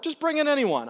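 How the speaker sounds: high, loud exasperated "Just bring in anyone"; exasperated host. Acted. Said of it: "She goes".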